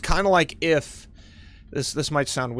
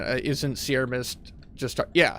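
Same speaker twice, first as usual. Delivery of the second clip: usual speaking voice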